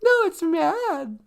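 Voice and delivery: high, slow mock-indignant whine; insisting in acting voice. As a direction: stay in the hammy character. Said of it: "that's not mad".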